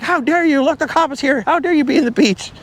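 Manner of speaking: high, squeaky mock outrage; hammed-up high indignant beachgoer; mock scream at swimmer. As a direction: scold the swimmer in a shrill put-on voice. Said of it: "You hear screaming".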